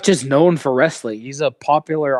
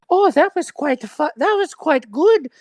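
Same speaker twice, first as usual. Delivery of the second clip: mock posh viewer; 13 semitones higher than usual. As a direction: imitate a prim, impressed viewer. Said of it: "people are like".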